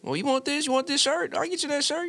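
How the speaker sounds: high, sweet, eager voice; overly nice suitor. Retold or imitated. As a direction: lay on the niceness, lightly high.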